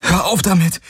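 high, agitated command; angry protest. Acted. Man orders the illusion to stop, agitated. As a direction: cut her off in distress. Galen rejects the tormenting illusion.

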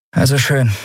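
slightly put-on voice, reluctantly agreeing; lightly voiced firm captain. A narrator's reading. Figure's dialogue in story reading. (leicht gespielte, bestimmte Kapitänsstimme)

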